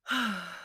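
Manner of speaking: soft exhaled sigh; weary sigh. Real, not acted. Vlogger recalling being laughed at, weary sigh. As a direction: let out a soft, tired breath.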